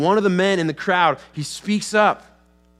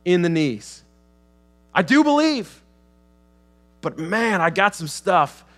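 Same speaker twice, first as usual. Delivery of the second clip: strained confession; 5 semitones higher, 4 dB louder than usual. Acted. Father confides his struggle.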